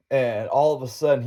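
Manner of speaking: ordinary talk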